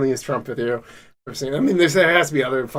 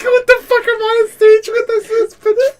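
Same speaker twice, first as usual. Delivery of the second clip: imitating Trump while laughing, much higher and louder; mock outrage lost in real laughter; laughing Trump quote; 5 dB louder than usual. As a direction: choke out the quote between laughs. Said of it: "It's just like".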